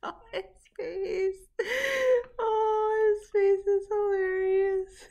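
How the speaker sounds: high-pitched laughing words. Real, not acted. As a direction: laugh through the words, delighted. Laughing at dog video.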